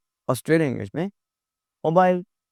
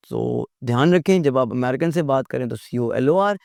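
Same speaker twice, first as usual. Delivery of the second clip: ordinary talk, nothing marked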